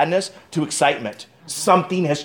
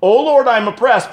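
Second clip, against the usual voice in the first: pleading king; 7 semitones higher, 5 dB louder than usual. Acted. Preacher voicing Hezekiah's plea.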